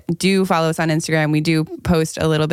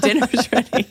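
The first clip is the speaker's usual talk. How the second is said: a light sing-song call, pitched up; mock call to dinner; 6 semitones higher than usual. Someone at home playfully calls out that dinner is ready.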